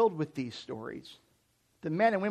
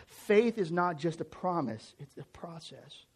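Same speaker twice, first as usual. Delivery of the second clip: slow emphatic maxim; slower than usual. Real, not acted. Key line, slow delivery.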